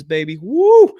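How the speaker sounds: high, loud woo of joy; a sudden loud celebratory cry; excited whoop. Real, not acted. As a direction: whoop it out like a win. Celebrating lower taxes.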